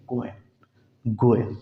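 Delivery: गोइंग said as go-en, no hard g; drawn out गोइंग ending in en; correct -in ending. Shown as a demonstration. Teacher models गोइंग for students, ending in en. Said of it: "गोइंग नहीं है".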